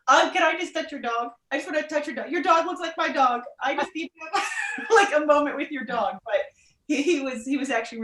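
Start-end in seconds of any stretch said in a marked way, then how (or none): 0.1-6.2 higher, gushing plea breaking into a laugh; eager pleading. Retold or imitated. Asking a stranger to touch his dog, higher eager plea with a laugh. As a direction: beg like a kid for the dog.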